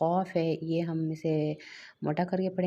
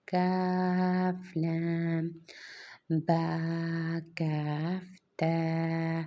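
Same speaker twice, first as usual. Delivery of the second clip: joined letter pairs stretched out very slowly; slow letter drill; much slower than usual. Shown as a demonstration. Teacher drilling joined letters slowly for children. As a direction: say each pair long and drawn out.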